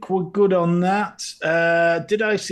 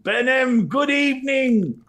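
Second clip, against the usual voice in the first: loud greeting; 9 semitones higher, 5 dB louder than usual. Acted. Announcing viewer greeting.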